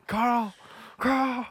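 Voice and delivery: high mock call, stretched out like Rick Grimes; mock Rick calling Carl. Friends mocking Rick Grimes, calling out for Carl. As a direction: holler Carl like a TV sheriff, teasing. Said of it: "He's pretending to talk like that".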